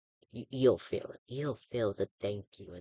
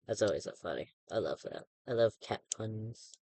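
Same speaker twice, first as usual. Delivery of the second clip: usual speaking voice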